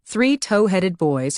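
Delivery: usual speaking voice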